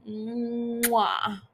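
long drawn-out "mwah"; kiss sound. Real, not acted. Fan praising an actor, exaggerated kiss sound. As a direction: relish a big stretched kiss noise.

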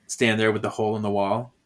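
ordinary delivery

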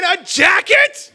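very high, slow, loud shriek of disbelief; outraged inner scream. Acted. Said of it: "But inside I'm thinking".

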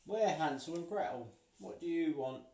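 put-on high, pushy child voice; demanding children. A narrator's reading. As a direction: demand it like a cheeky kid. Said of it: "demanded the children".

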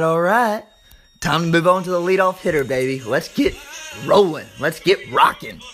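ordinary talk

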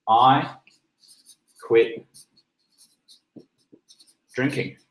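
halting, with long pauses between words; hesitant pauses. Shown as a demonstration. Announcing you quit drinking, slow and halting. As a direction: drag it out word by word. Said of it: "That's it".

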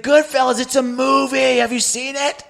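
high, insistent explanation; insistent calling out. Acted. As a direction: spell it out in a high, straining call. Repeating himself over loud music.

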